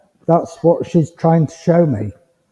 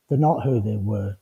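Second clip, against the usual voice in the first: lower, softer repeat to let it sink in; hushed, lower restatement; quiet echo; 7 semitones lower, 7 dB quieter than usual. Shown as a demonstration. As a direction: drop the voice low and soft for the echo. Quiet emphatic echo of line before.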